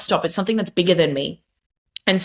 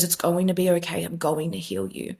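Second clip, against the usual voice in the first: soft, lower, tender promise; gentle reassurance; 3 semitones lower, 7 dB quieter than usual. Retold or imitated. Jesus comforting her on her knees, soft and tender. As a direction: fade to a hush as you comfort. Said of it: "he puts his hand on my head and he goes".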